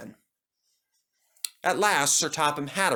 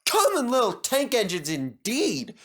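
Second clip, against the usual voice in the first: higher, huffy scorn; snorting indignation; 11 semitones higher than usual. Acted. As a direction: scoff at the insult. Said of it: "snorted Thomas".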